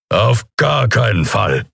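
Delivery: slow, emphatic refusal; protesting. A narrator's reading. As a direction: refuse firmly, drawing the words out. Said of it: "protestierte Kero". (langsam und nachdrücklich protestierend)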